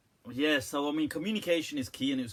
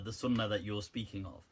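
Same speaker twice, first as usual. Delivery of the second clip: ordinary talk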